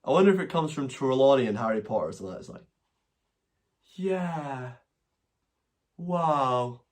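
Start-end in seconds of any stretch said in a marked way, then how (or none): 3.9-6.8 a light, vague, distant posh English "Yeah. Wow."; drifty posh accent. Retold or imitated. Scottish vlogger mimicking the posh flatmate, vague and drifty. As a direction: float the words, dreamy and detached. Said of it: "slightly distant, vague".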